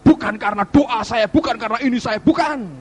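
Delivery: high, loud preaching cry; impassioned denial. Real, not acted. Preacher denies any credit for his own prayers, impassioned and loud. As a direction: build to a forceful, high final refusal.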